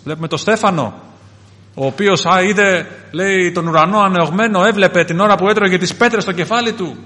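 loud, high sermon storytelling; impassioned preaching. Acted. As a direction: preach the story with rising force. Preaches the stoning of Stephen.

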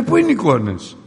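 high, loud demand; confronting mother. Retold or imitated. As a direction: confront the son. (η μάνα απαιτεί απάντηση από τον γιο, δυνατά)

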